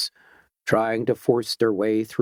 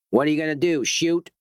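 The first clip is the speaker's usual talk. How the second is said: mild narrated roar; 6 semitones higher than usual. A narrator's reading. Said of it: "roared the man".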